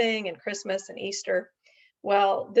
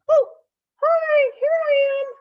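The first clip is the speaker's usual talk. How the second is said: much higher, louder puppet voice; 9 dB louder than usual. Acted. Puppet greeting the host, much higher puppet voice. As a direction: pop up bright as a little puppet.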